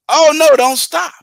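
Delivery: "Oh, no, don't stop" rushed as one phrase; no break after "no"; run together. Shown as a demonstration. Driver mishearing the warning, run together. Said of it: "I'm hearing".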